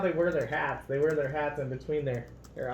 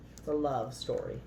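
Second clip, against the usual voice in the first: usual speaking voice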